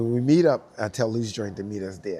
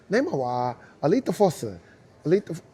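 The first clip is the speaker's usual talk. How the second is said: put-on Japanese accent; 5 semitones higher, 6 dB louder than usual. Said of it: "that's how we say it in Japanese".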